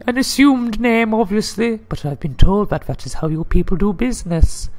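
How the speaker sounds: high-pitched put-on lady's voice; high falsetto woman. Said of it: "I get to do the lady voice".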